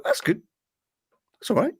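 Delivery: small, quiet nod of approval; mildly impressed. Retold or imitated. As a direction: approve modestly, as if only half won over. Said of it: "Not blow you away".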